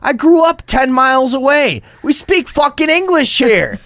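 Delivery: very high, loud ranting; shrieking at the store clerk in a rage; retold screaming. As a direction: scream it like a drunk meltdown. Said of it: "I'm like".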